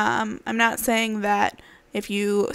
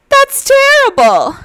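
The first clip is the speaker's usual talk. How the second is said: gasp, then high loud dismay; horrified little animal crying out; shocked gasp; 13 dB louder, slower than usual. Acted. Frog shocked by wolf.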